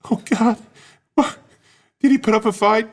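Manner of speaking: high, lightly voiced anxious wife; a hint of a worried wife; high widow voice.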